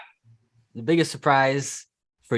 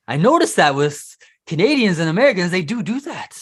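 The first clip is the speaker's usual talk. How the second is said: higher, louder imitation of excited students; excited recognition; 8 semitones higher, 7 dB louder than usual. Teacher voicing students who spot gringo habits, excited recognition. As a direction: mimic a student's eager discovery.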